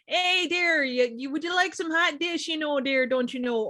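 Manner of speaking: put-on high, sing-song northern Wisconsin accent; chirpy Wisconsin genie offering a wish; northern Wisconsin accent, friendly Midwest lilt. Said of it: "northern Wisconsin accent".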